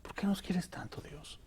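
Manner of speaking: a broken whisper to God; tearful whispered prayer. Retold or imitated. Man retelling his prayer to God, whispered. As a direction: whisper it, moved to tears. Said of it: "Y yo lloraba y decía".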